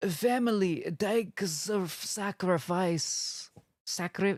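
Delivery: put-on Italian accent. Chat asks for an Italian accent, hammed-up Italian accent. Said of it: "Now do it all in an Italian accent".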